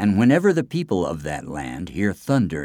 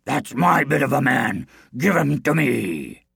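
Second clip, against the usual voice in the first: big roaring demand, pitched up; roaring giant; 5 semitones higher than usual. A narrator's reading. Rival giant demands the man back, roaring voice. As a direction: roar it, drawing out the final demand. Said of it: "he roared".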